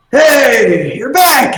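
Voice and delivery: high, drawn party cheer; welcoming shout. Acted. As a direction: hail returning friends with a high shout. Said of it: "they look back at you and go".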